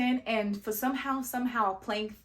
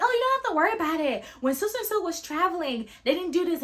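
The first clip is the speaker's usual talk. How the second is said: mom's voice; 6 semitones higher, faster than usual. Retold or imitated. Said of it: "My mom was like".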